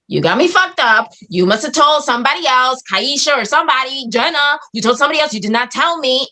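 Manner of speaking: retold indignant accusation. Woman accusing her friend of telling someone else, retold indignant accusation. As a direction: argue back, stressing every charge. Acted quarrel with friend Crystal.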